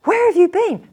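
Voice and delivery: high, loud mock outrage; hammed-up indignant cry; mock demanding shout. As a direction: yell it like a partner fed up with waiting. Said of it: "and you're going".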